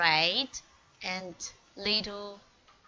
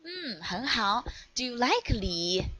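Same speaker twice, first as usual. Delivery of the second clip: ordinary delivery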